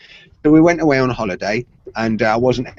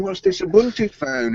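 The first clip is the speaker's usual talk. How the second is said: higher-pitched imitation of intrigued coworkers; curious onlookers; 6 semitones higher than usual. Everybody at the bank asking wife, curious. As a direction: ask like an impressed onlooker. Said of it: "because everybody's going".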